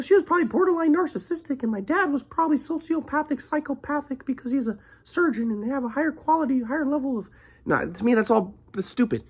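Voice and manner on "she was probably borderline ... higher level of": mock diagnosis. Mocking people who diagnose him.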